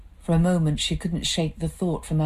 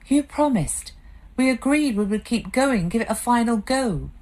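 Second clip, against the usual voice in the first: put-on higher, hurt young wife pleading; Claire's pleading voice; 7 semitones higher than usual. Wife confronting her drinking husband, hurt and pleading. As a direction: remind him of his promise, stung.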